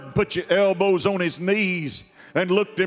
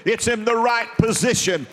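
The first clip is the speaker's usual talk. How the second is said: rising preaching; 4 semitones higher than usual. Acted. Preacher rises into full preaching.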